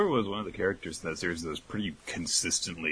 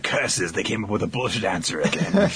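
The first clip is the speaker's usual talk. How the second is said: villain voice; 4 semitones higher, 7 dB louder than usual. Retold or imitated. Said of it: "they're like".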